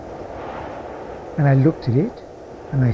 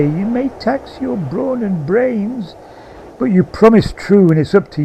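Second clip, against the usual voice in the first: corpse's voice; 7 semitones higher than usual. A narrator's reading. Lashed corpse to the man hauling it, high eerie chant. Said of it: "it seemed to say".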